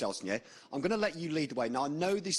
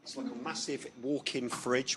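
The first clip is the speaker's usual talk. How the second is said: ordinary delivery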